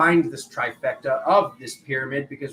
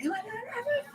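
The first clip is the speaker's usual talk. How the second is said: much higher, hammed-up teary disbelief; mock weepy squeak; 8 dB quieter than usual. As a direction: plead for reassurance like a fragile child. Mock fishing for compliments.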